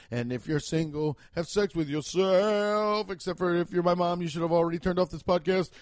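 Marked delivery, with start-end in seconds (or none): 1.3-3.1 slow, put-on bedroom voice; mock sultry. Advising single listeners, mock sultry. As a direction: drawl it like a joke seducer.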